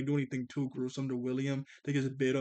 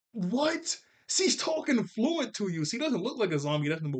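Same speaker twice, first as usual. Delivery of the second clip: exasperated disbelief; 7 semitones higher than usual. Real, not acted. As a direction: protest in high-pitched disbelief. Mock outrage at Herb's stupidity.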